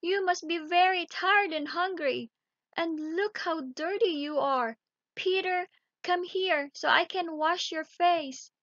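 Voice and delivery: high, loud motherly fussing; a mother fussing over her dirty children. Acted. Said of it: "she cried".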